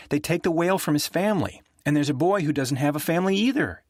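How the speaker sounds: put-on high, earnest little-girl voice; a child gravely explaining a sad film; young girl. Young Julia telling the grown-ups about the film, in a high child voice.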